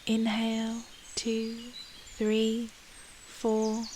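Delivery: suspended, spaced-out "two, three, four"; inhale count. Shown as a demonstration.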